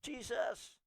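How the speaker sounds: half-hearted cry for help to Jesus; retold shout. Retelling a woman's scream in a quake clip, mild mock plea. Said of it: "they shouted".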